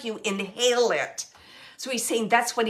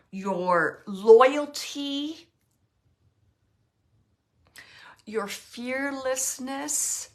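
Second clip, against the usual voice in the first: near tears; much slower than usual. Real, not acted. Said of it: "I almost cried on that one".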